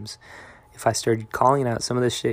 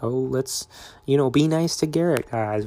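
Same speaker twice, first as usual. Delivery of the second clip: higher-pitched imitation of pandering friends; mock pandering; 4 semitones higher, 5 dB louder than usual. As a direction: mimic them being oh-so-nice, pitched up. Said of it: "in a kind of pandering almost".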